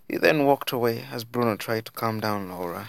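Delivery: usual speaking voice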